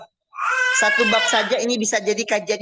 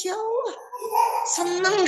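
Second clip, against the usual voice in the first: tears of joy; 10 semitones higher, slower than usual. Retold or imitated. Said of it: "nangisnya bukan nangis sedih, tapi nangis".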